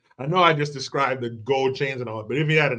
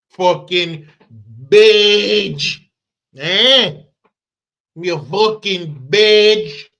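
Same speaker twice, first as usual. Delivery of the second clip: loud, very slow, drawn-out shout; hammed-up demand; 4 semitones higher, 9 dB louder, much slower than usual. Wannabe agent demanding a badge, hammed-up shout. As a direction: stretch each word out loudly.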